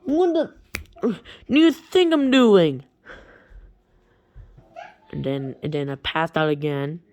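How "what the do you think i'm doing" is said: high, loud mock scream. Ren dressed as a nurse screaming at the boy, loud high mock scream. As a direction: snap back at the question. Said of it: "just, uh, screamed at me".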